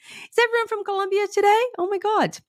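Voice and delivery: laughing, high and excited; thrilled to see another Colombian viewer; delighted surprise. Real, not acted. Host greeting yet another Colombian viewer, delighted and laughing.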